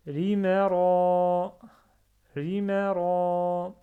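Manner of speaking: Rimara read slowly, the long vowels held; slow stretched Rimara; prolonged vowels. Shown as a demonstration. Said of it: "je prononce deux fois, même trois fois".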